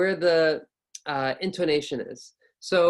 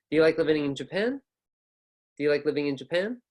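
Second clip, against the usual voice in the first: "Do you like living in Japan?" with pitch rising on "Japan"; upward question intonation; faster than usual. Shown as a demonstration. Teacher giving an example question, rising intonation. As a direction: lift "Japan" up as an open question.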